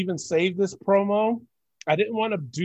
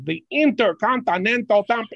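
mock Nigerian accent, higher, every syllable punched; hammed-up Nigerian accent, pitched up; mock overemphasized accent; 6 semitones higher, 3 dB louder than usual. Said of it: "he emphasizes a lot of words".

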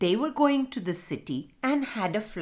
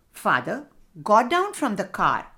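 plain reading voice

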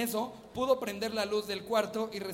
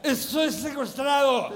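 put-on slow slurred drunk shout; 6 semitones higher, 6 dB louder, slower than usual. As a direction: play the drunk uncle calling for help. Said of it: "se notaba su voz de borracho".

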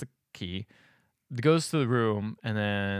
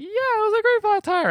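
very high, louder put-on jitters; shaking, mock nervous; 5 dB louder than usual. Imitating wife lying at dinner, mock nervous shaking. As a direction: squeak out a badly covered lie.

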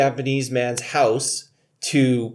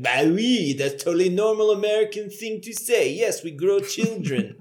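higher, put-on French accent; exaggerated French accent, pitched up; mock French accent; 5 semitones higher than usual. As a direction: play a French dad with a hammed-up accent. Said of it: "the French dad is like".

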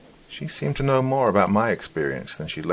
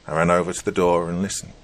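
ordinary delivery, nothing marked

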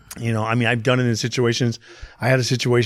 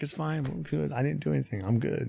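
softer, slightly higher voice; retold soft reassurance; 4 semitones higher, 10 dB quieter than usual. Husband reassuring wife after the fight, retold softly. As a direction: quietly brush off the worry.

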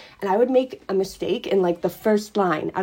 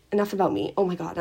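ordinary talk, nothing marked

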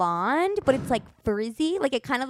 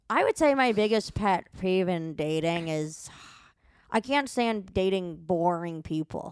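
mock boring guy; 4 semitones lower, slower than usual. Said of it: "he goes".

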